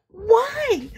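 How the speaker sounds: loud, high incredulous squeak; a hammed-up cashier who can't believe it; aghast why. Cashier horrified she'd cut up shirts, loud high squeak. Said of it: "she about had a fit".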